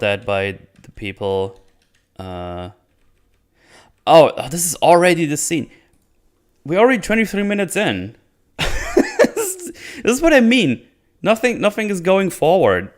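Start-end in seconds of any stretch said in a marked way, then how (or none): 4.1-8.2 higher-pitched dismay at the discovery; exasperated disbelief. Real, not acted. Exasperated at slow episode. 8.6-10.0 high, wheezy laugh; incredulous laugh. Real, not acted. Laughs at the slow pacing. 10.0-12.9 high-pitched, emphatic exasperation; exasperated complaint. Real, not acted. Complaint after laughing at pacing.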